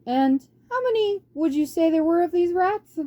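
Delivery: put-on calm the council like a kindly old monk; old abbot. Father Abbot asks.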